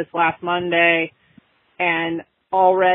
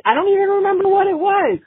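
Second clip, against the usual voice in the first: much higher, mock-helpless pleading; exasperated admission; 12 semitones higher, 3 dB louder than usual. Wife admitting to husband she forgot the dream, high mock helplessness. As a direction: toss it up in playful frustration. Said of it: "I said".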